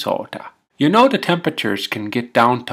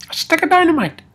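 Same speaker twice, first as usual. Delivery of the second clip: much higher, incredulous exclaim; disbelief; faster than usual. Acted. Man stunned the driver hands him dynamite, high incredulous exclaim. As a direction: squeal it in disbelief.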